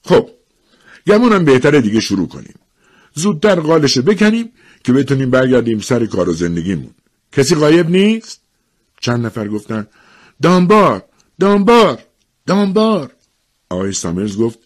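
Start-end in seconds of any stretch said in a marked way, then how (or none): 0.0-8.4 raised, louder official voice; serious. A narrator's reading. Official opening the lottery to townsfolk, serious. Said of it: "با قیافهٔ جدی گفت". 10.4-13.1 high, loud calls of a name; calling out. Acted. Townsfolk naming the absentee to the official, calling out. Several in crowd call a name.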